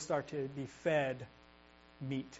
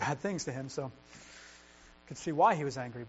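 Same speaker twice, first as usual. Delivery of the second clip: ordinary talk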